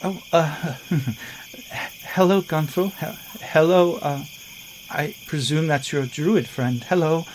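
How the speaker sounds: bashful, stumbling hellos; Lorana's shy voice. Acted. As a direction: Lorana greeting Gunther awkwardly after transforming. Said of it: "And she says".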